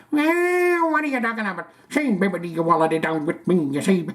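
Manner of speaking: high, strange put-on [name] accent; [name] impression. Reading a viewer's comment in a hammed-up [name] accent. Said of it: "I also love your [name] accent".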